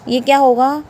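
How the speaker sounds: normal talking voice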